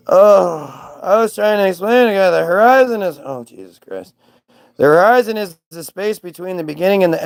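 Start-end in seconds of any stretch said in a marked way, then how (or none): none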